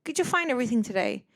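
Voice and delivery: high bright shop-clerk greeting; high, fast store-clerk welcome; perky clerk. Retold or imitated. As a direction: chirp it like a cheerful American cashier. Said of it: "it would be like".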